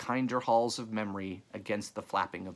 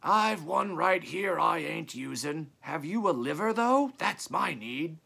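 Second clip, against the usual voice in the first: ancient character haggling aloud; ancient croaking voice; 7 semitones higher, 3 dB louder than usual. A narrator's reading. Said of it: "an ancient croaking voice".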